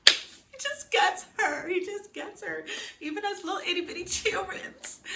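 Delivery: high, gushing swoon; gushing squeal. Real, not acted. Fangirl swooning over the sweet flashback, high. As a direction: swoon over how he gets her.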